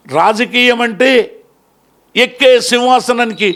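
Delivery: impassioned public speech, swelling on the calls; stirring address to mothers and youth; fiery oration. Acted. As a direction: rouse the crowd like a folk orator.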